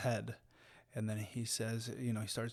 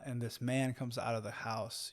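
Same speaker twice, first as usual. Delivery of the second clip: usual speaking voice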